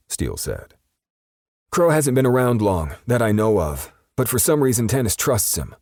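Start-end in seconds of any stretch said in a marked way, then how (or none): 1.7-5.8 high, loud nervous rush; nervous perp. A narrator's reading. Frightened perp telling Steele about Crow, high and nervous. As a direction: spill it all out nervously.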